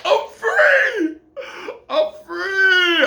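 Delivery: half-crying shout of freedom; overjoyed release. Retold or imitated. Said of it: "I was like".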